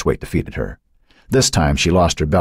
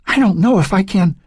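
put-on young girl voice; 13 semitones higher than usual. A narrator's reading. Becky straining under Matt.

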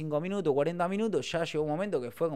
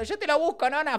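high, loud child imitation; pitched way up, excited helper; imitated eager little nephew; 12 semitones higher, 4 dB louder than usual. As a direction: mimic an eager kid rushing to help. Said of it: "Mami y sobrinito que venían corriendo".

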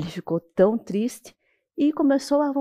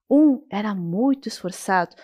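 plain reading voice

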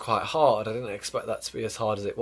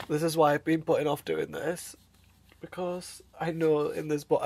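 higher, choked voice on the verge of tears; tearful, close to crying; 5 semitones higher than usual. Real, not acted. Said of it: "I'm literally sat under my bed sobbing".

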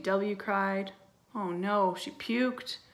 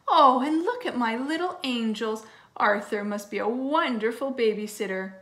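lightly voiced delighted mom, louder; effusive, higher-pitched praise; gushing mother; 3 semitones higher, 6 dB louder than usual. A narrator's reading. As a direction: coo proudly over little angels. Mother gushing over twins.

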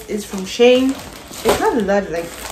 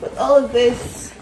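excited; 8 semitones higher, 7 dB louder than usual. Real, not acted. Excited about wearing new shoes.

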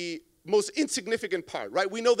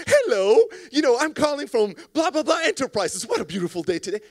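hammed-up salesperson, loud and high; fake salesy; 5 semitones higher, 6 dB louder than usual. Salesperson cold-calling from a made-up company, fake salesy. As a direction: oversell it with phony cheer, loud hello first. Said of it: "like, in a fake salesy".

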